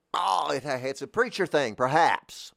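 a hint of a Southern preacher. Shown as a demonstration. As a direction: rise into a Jerry Clower sermon tone. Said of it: "my Jerry Clower".

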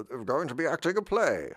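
affected, theatrical announcing with a swooping tune; stagey radio-play voice. Acted. Mocking how UK radio plays sound, stagey and affected. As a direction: put on an unnatural radio-drama voice. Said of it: "no one talks like that".

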